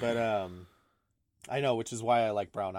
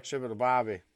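put-on Australian accent; 3 semitones higher, 7 dB louder than usual.

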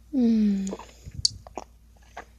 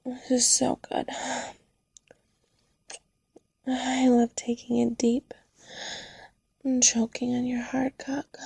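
breathy close-mic whisper. Acted.

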